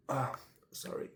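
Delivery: ordinary delivery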